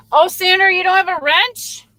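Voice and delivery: high, loud call to a friend; light up as she drops in; happy shout-out to a viewer; excited greeting. Real, not acted.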